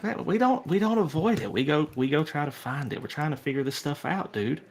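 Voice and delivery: hyped club-mate voice; confident jock buddy. Acted. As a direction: start high and insistent, then ease down. Plays the jock buddy Dingo.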